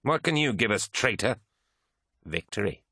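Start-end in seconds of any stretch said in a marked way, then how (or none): 0.0-1.4 high, scornful challenge; scoffing. A narrator's reading. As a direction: throw the question back with contempt. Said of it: "scoffed Eidolon".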